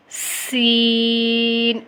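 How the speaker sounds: سین with a whistling s and long "ee"; firm, drawn-out سین; firm, slight whistle. Shown as a demonstration. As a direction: hiss the s of سین, then hold the vowel. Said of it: "سین کو سختی سے پڑھیں گے اور اس میں تھوڑی سی سیٹی آئے گی".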